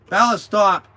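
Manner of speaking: sharp, high, loud command; real scolding call. Real, not acted. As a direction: call out to make Bella stop. Breaks off to scold Bella.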